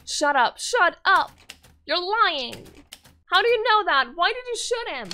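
loud, high shrieked denial; furious scream. Acted. Girl screaming at the hunter who shot her father, furious. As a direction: scream it at him in rage.

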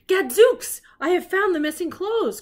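loud, high Gadzooks exclamation; triumphant cry. A narrator's reading. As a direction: shout the discovery proudly. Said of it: "cried Sherlock".

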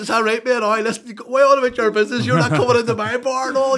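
loud, high, tense warning, get out of my bar; loud Belfast bouncer telling him he's not welcome; firm warning off. Retold or imitated. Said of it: "you know, that sort of way".

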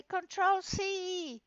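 high, slow sing-song pupils' answer; mock class chanting in unison; children answering. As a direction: drawl it like a class chanting back. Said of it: "And they will say".

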